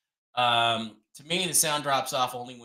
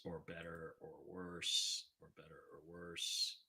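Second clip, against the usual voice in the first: much softer, slow; soft mic test; 6 semitones lower, 19 dB quieter than usual. Shown as a demonstration. Streamer testing the mic by speaking softly. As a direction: let it drift quiet. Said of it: "when I talk quietly".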